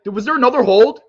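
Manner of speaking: high, loud disbelief; real fan disbelief; incredulous shout. Real, not acted. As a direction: shout the question in outrage. Fan seeing another penalty.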